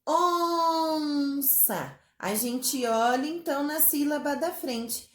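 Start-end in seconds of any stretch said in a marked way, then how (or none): 0.1-2.0 "onça" with "on" held long and higher; stretched syllable. Shown as a demonstration. Teacher showing long syllables, "onça" drawn out. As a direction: sustain the start of "onça", then a short "ça". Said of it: "que têm as sílabas compridinhas".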